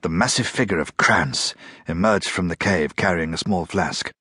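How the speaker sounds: ordinary delivery, nothing marked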